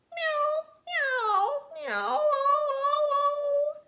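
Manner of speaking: very high, slow wailing meows; a sad cat singing its cry. A narrator's reading. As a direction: yowl the meows like a weeping cat. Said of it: "he saw a cat crying".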